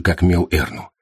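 usual speaking voice

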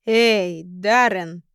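put-on slurring drunkenly; drunk slurred mumble. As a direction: mumble it with a drunk, heavy tongue. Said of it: "заплетающимся языком пробормотал".

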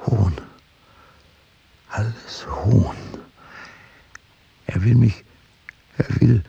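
breathy thoughts rising in pitch and force; agitated inner monologue. Acted. Prosecutor feeling mocked on stage, agitated inner monologue. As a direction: build from breath to pressing alarm.

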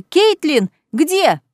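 high, loud eager call; excited shout. Acted. Sister backing her sister up, loud eager cry. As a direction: join the hunt, shouting.

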